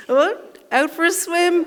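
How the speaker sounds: high, loud, chirpy small talk; oblivious dog owner. Retold or imitated. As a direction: call it out like a casual greeting. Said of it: "And he's just like".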